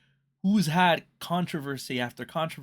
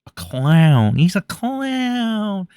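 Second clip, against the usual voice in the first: loud, drawn-out jeer on "clown"; mocking call; 6 semitones higher, 10 dB louder, much slower than usual. Real, not acted. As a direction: stretch out "clown" loudly, rising higher. Mocks the man as clown.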